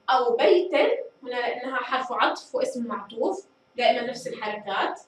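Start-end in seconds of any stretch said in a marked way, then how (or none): none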